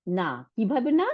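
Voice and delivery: ordinary talk, nothing marked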